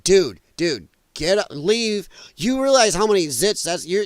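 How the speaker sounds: ordinary talk, nothing marked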